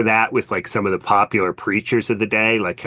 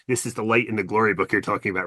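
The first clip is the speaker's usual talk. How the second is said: ordinary talk, nothing marked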